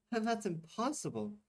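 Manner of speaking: put-on high, dismissive young woman; Tamika scoffing. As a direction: laugh off the idea. Tamika laughing it off.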